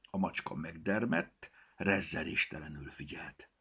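usual speaking voice

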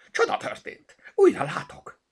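high, loud jubilant cry; excited shouting. A narrator's reading. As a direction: shout the good news in wonder. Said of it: "kiabálta Zorka". (ujjongó kiabálás)

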